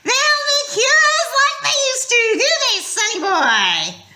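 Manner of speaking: high, loud sneering hag voice; mocking monster voice. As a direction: taunt the boy as a cruel monster.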